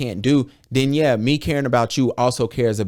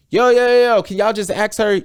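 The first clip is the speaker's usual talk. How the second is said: higher and louder, cutting in fast; exasperated interruption; 8 semitones higher, 6 dB louder than usual. Retold or imitated. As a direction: break in to stop the rapid questions. Cutting off nurses' rapid questions.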